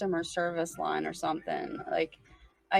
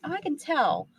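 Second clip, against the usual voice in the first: higher, louder Southern lady voice; Southern accent, pitched up; imitated Southern accent; 8 semitones higher, 4 dB louder than usual. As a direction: mimic a knowing Southern lady's accent. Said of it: "And the lady's like".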